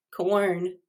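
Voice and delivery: usual speaking voice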